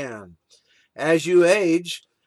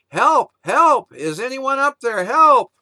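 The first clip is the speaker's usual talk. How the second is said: high, loud cries for help; desperate shouts for help; shouting for help; 8 semitones higher, 6 dB louder than usual. Acted. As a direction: yell up from the cliff edge. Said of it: "Jack began yelling for help".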